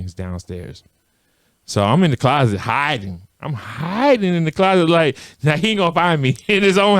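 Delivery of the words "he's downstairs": quiet. Retold or imitated. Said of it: "I hear my mom saying".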